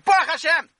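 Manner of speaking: bursts out high and loud in thanks; excited exclamation. Acted. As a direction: cry it out with sudden joy. Joyful exclamation in Rebbe story.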